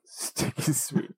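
slow, hushed sultry whisper; whispered catchphrase. Pretend. Co-host echoes the sticky sweet catchphrase, sultry whisper. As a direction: whisper it back, drawn out and sibilant.

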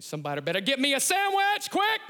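high, loud mock hangry yell; mock hangry shout; mock hangry demand. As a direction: bark it like a cranky hungry man. Acting out getting hangry.

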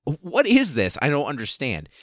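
a baffled cartoon squeak of disbelief; incredulous high-pitched confusion; bewildered disbelief. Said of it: "be like".

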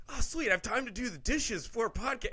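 mock delight at a chore; cheerful. Retelling glee over doing the dishes. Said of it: "I felt happy about it".

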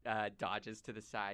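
normal talking voice

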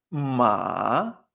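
"ma" long, low, then rising; third tone. Shown as a demonstration. Teacher reviewing the word for horse, "ma" dipping slowly. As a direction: sink down on "ma" and climb back up. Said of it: "en tercer tono".

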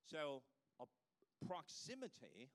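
usual speaking voice

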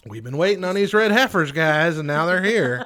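loud, high excited announcement. Real, not acted. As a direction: hype it up like the end is near. Excited joke about red heifers.

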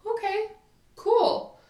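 lifted, high-pitched shrug in the voice; breezy acceptance. Acted. Answering an ex-friend who wants out, breezy acceptance. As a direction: pitch it way up, no big deal.

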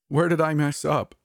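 high, crying plea; mock-sob, high and pleading; tearful. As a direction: break down asking it. Narrator crying, can't keep in.

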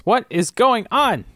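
confused. Real, not acted. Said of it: "crisscross applesauce".

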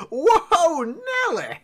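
high-pitched whooping cry; whooped exclamation in a high character voice; Edward. Acted. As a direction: holler it high, starting loud. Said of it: "Edward goes".

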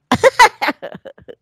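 bright high burst of laughter. Real, not acted. Laughing at Dave's chuckling joke with a high laugh.

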